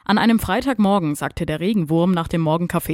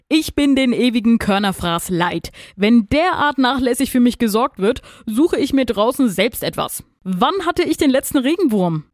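higher, punchy cockerel's rant; snapping angrily; 6 semitones higher, 3 dB louder than usual. A narrator's reading. Rooster rails at his hens over food, snapping angrily. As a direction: scold the hens in indignation. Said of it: "fuhr er sein Lieblingshuhn Mathilde an".

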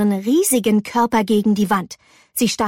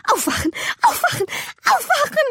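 squeaky, rising repeats; frantic self-urging; faster than usual. Acted. May slaps her face to wake up, frantic. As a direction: urge yourself, climbing to a high squeal.

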